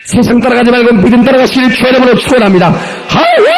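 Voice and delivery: high blessing bursting into a shouted hallelujah; rallying pulpit shout. Real, not acted. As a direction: build to a soaring hallelujah cry. Preacher rallies congregation to hallelujah. (높이 외치는 축원과 할렐루야)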